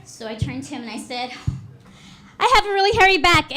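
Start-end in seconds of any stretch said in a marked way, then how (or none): 2.4-3.6 loud, high, rushed blurt; panicked blurt. Acted. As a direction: blurt it out fast and far too loud. Awkward flirter blurting out reply.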